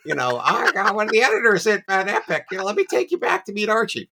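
high squeaky starstruck greeting to a famous author; squealing fanboy. Retold or imitated. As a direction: squee at your hero like a giddy fan. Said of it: "squeed my way up the hallway".

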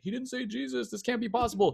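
high-pitched scandalized inner voice; mock panic. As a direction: fret in a high, protesting voice. Mind panicking over a tarot deck.

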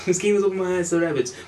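laughing, high mocking repeat; mocking echo. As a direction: parrot his words back teasingly. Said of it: "laughs, echoes him mockingly".

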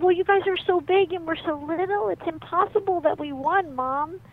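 put-on high, loud, insistent child; little boy's voice. Little boy explains the tug of war to Mom, insistent. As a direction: plead the case like a small kid.

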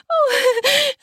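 high, breathy whimpered "oh". Acted. Wife bent over suddenly, whimpering. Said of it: "I whimpered".

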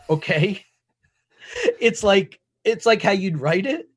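normal talking voice

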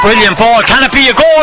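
rising, loud, high excitement; building to the goal call; excited. Real, not acted. Goal chance called live.

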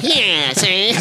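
mock nineteen thirties gangster voice; 1930s gangster. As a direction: play an old-movie tough guy, see. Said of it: "like a nineteen thirties gangster".

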